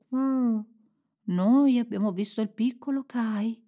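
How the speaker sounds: coo, then speak like a dove. A narrator's reading. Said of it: "una delle colombe disse".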